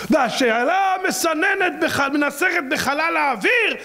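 high, slow, shouted preacher's cry; preaching cry. Acted. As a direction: let the question ring out slowly. Preacher's dramatic rhetorical climax. (קריאה דרשנית גבוהה ואיטית)

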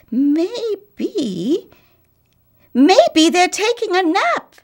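put-on high, loud little girl's cry; girl calling out. Little girl shouting to the houses, high and loud. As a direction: call it out like an eager little girl. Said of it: "the little girl screamed out".

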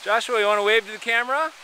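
high, loud, coaxing call. Real, not acted. Parent asking Joshua to wave to the camera, calling out. Parent calls to child skiing.

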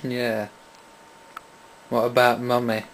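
ordinary talk, nothing marked

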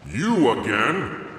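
slow, gruff north wind; a hint of a howling wind spirit. North wind annoyed at the boy's return, gruff and slow. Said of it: "howled the north wind".

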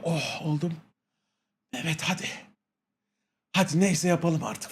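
softly surrendering under the sedative; sedated murmur. Retold or imitated. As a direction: murmur it like you're melting into the bed. Sedated, giving in.

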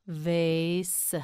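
"Vase" with a long held vowel; drawn out. Shown as a demonstration. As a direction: stretch "Vase" for a learner. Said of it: "You say it".

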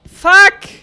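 shouted curse in panic; loud startled curse. Real, not acted. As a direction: yell it as it hits. Monster attacks.

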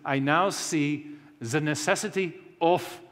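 put-on measured delivery with a German accent. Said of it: "that's my German accent".

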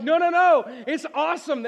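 a landscaper fired up about grass seed; excited insistence. Retold or imitated. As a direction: insist it's awesome, all fired up. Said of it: "He's like".

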